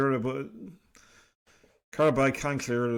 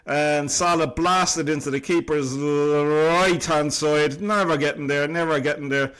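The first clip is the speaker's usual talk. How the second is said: loud, energetic play-by-play call; live commentary; 4 semitones higher, 7 dB louder than usual. Acted. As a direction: call the replay like a broadcaster. Replays goal as live commentary.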